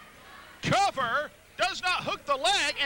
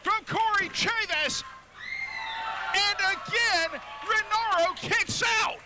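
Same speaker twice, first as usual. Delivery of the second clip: excited call; 6 semitones higher, slower than usual. Real, not acted. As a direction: call the big move with a lift. Big move called live.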